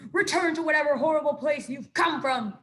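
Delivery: loud, high shout; fearful shout. A narrator's reading. Weasel yelling at the robot, frightened and loud. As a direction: shout them away.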